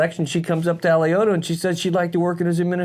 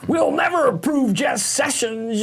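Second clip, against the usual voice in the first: mocking whiny politician; 11 semitones higher, slower than usual. Mocking Warren in high voice.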